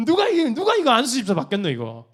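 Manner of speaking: high, loud scoffing Gyeongsang-dialect quip; mocking church member. Church member scoffing at the deacon appointment, high and loud. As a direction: scoff that no one would accept the deacon post. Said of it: "막 그러면서".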